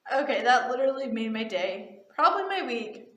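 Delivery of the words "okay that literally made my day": usual speaking voice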